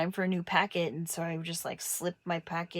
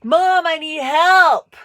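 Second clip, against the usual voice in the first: loud, high, slow wail; mock plea for help; 9 semitones higher, 16 dB louder, much slower than usual. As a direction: cry out dramatically for mom. Punchline plea to mom.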